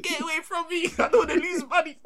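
very high, loud yelp; hammed-up squeal of alarm; comic high-pitched shout; mock panic. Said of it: "I literally said".

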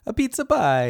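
high, loud cartoon Italian; mock Italian accent. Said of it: "It was like that".

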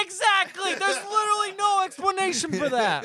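very high-pitched real exasperation; exasperated agreement. Real, not acted. Recapper agreeing the plot has no explanation, exasperated. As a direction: burst out in vehement agreement, voice shooting up.

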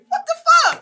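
amazed laughing what the fuck; disbelief. Retold or imitated. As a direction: blurt it out half-laughing in shock. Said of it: "was like".

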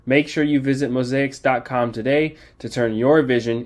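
loud, punchy commercial pitch; booming motivational speech. Acted. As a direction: sell it big like a TV ad. Booming sponsor ad read.

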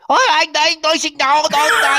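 high, loud shouted mock Chinese; sudden mock yelling. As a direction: erupt into a high-pitched imitation of foreign yelling. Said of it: "it's all silent. And then you hear".

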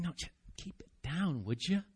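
hushed, shushing voice; quiet scolding hush; hushing crowd. Retold or imitated. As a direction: shush him down like an annoyed crowd. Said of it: "Why is this crowd saying".

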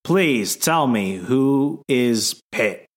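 drawn-out, very slow question; slow plea. Real, not acted. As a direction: stretch every word out, pleading. Host confused, asking slowly.

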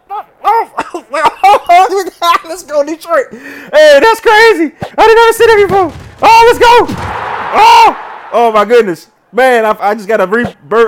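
screaming with delight at the comeback; ecstatic. Real, not acted. Gamer celebrating a wild last play, ecstatic shouts.

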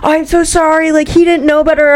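mock a flustered friend saying sorry; apologetic friend.

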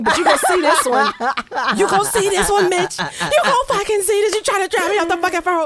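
high, taunting rant at truck drivers; acted road rage. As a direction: build into hammed-up fury, pitch climbing. Acting out shouting at truck drivers.

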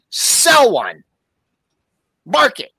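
high, clipped order barked into the phone; curt phone order. Retold or imitated. Trader calling in a tiny order by phone, mock urgent. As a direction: snap the order down the line, high and loud. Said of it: "and he goes".

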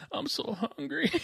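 hammed-up weepy whine of a hungry man; mock crying. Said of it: "It was".

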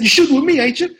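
loud, high incredulous demand; Sam Jackson. Retold or imitated. Star questioning a rookie who admits nerves, exasperated. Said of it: "He said".

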